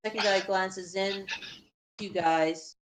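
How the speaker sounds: usual speaking voice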